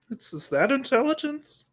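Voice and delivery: high, cracking Kermit-like voice; nasal-free high mock lecturer; Jordan Peterson impression. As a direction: squeak it out like a voice about to break. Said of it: "it's so good to do the Jordan Peterson".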